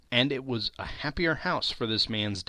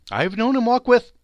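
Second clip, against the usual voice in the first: high, loud, strained cheerfulness that falters; faltering cheer; 12 semitones higher, 9 dB louder than usual. A narrator's reading. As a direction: force the cheer until it gives way. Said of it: "steady, cheerful voice that only faltered once".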